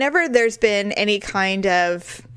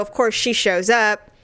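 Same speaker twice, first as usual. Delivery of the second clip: ordinary talk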